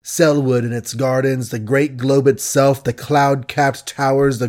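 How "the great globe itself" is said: ordinary delivery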